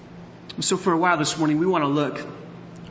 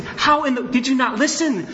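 angry scolding; 9 semitones higher than usual. Retold or imitated. Father scolding his brawling boys, high exasperated yell. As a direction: let the boys have it. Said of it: "And I'm just saying".

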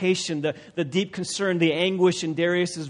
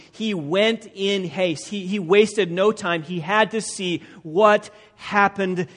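fervent sermon delivery; preaching at full force; 3 semitones higher, 6 dB louder than usual. Acted. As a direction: build urgency from the pulpit. Preacher builds intensity.

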